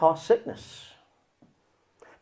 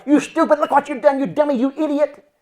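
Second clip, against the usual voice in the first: loud, high scolding; condemning; 13 semitones higher, 10 dB louder than usual. Acted. Condemner part scolding someone who got off track, condemning. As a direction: berate them as the inner condemner. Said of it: "It's common to find the condemner".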